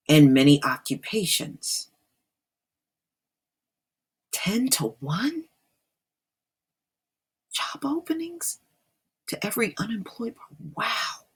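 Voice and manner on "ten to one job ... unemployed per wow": stunned disbelief. Real, not acted. Reacting in disbelief to statistic.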